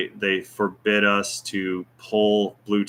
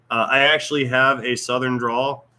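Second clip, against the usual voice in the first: ordinary talk, nothing marked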